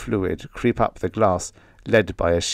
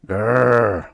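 growl; 3 semitones higher, 4 dB louder than usual. A narrator's reading. Channing growls at the hated name, mock growl. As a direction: growl like an annoyed dog at the name. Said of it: "growled Channing".

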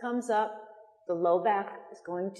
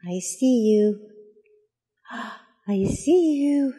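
peekaboo voice; 3 semitones higher, 6 dB louder, slower than usual. Acted. Peekaboo with baby.

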